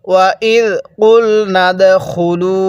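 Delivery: drawn out tajweed chant; slow recitation. Shown as a demonstration. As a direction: stretch every vowel, slow and measured. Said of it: "এরকমভাবে পড়ব".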